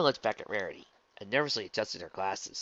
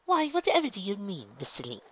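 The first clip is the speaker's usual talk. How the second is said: innocent Rarity voice; 10 semitones higher than usual. Acted.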